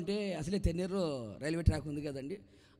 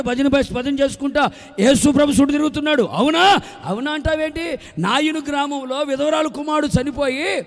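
loud, high full-force revival sermon; fervent preaching; 7 semitones higher, 18 dB louder than usual. Acted. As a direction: proclaim the healings to the crowd. (ఉత్సాహంగా, గట్టిగా బోధించడం)

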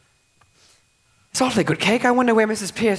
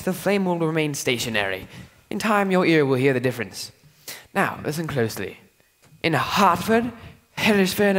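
usual speaking voice

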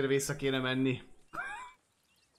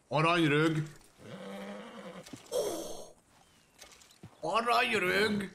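calling out; 6 semitones higher than usual. Real, not acted. Player calling their horse back, calling out. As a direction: holler the name across a distance.

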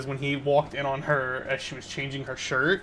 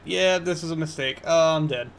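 normal talking voice

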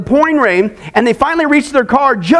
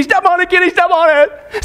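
higher-pitched urgent yell; panicked yell; 5 semitones higher than usual. Acted. Al yells to Kenny to drive, high and urgent. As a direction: yell it, desperate to get away. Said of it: "he yells to Kenny".

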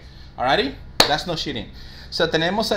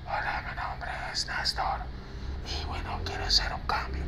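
unvoiced whisper of a quiet customer; hushed, whispered phone introduction; speaking too softly; 10 dB quieter than usual. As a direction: whisper it like a caller who can barely be heard. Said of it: "No te está hablando alto, simplemente".

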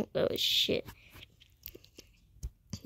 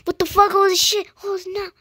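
high-pitched, loud shocked yell; acted shriek of disbelief; startled outburst; 9 semitones higher, 6 dB louder than usual. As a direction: burst out in high-pitched alarm.

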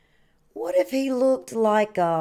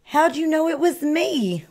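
higher-pitched mild wail; a touch of storybook wailing; 6 semitones higher than usual. A narrator's reading. Turkey, unmasked by Sheep, asks with a mild high wail. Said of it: "wailed Turkey".

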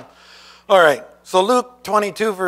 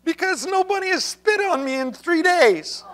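much higher, fretful worried brother; anxious brother; 12 semitones higher than usual. Retold or imitated. Brother fretting to John Wesley that he's backslidden, high and anxious. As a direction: whine it like a man sure he's lost. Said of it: "He goes".

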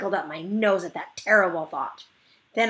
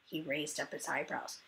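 ordinary delivery, nothing marked

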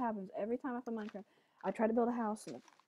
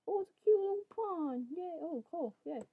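high, bright squeal of delight at a cute find; delighted discovery; 8 semitones higher than usual. Real, not acted. Gamer spots a cute pond while building, excited high squeal.

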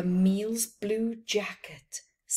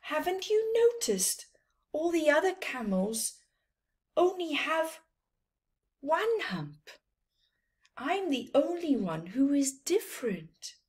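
sadly; 7 semitones higher, faster than usual. Acted. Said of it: "Camille said sadly".

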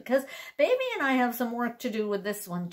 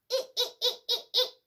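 much higher squeaky eeks; monkey; 12 semitones higher, faster than usual. Acted. Storyteller voicing the monkey for kids, squeaky. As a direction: chatter like a little monkey. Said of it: "says a monkey".

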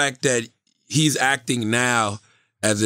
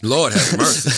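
mock Jamaican exclamation.

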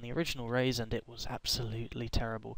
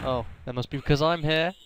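ordinary delivery

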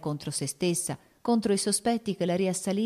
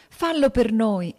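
slow, louder and higher, imploring; pleading; 5 semitones higher, 7 dB louder, slower than usual. A narrator's reading. Matilde begs her husband not to go out. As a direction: beg him slowly, hands joined. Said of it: "scongiurò Matilde a mani giunte".

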